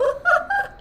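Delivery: high-pitched laugh; high laughter after a joke. Real, not acted. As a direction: let out a high, helpless laugh. Laughing at her own remark.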